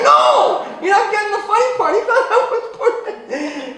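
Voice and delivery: very high, loud insistence through laughter; laughing shout. Retold or imitated. Telling friends the funny part, in a high laughing shout. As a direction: shout over them, cracking up at your own joke. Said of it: "I'm like".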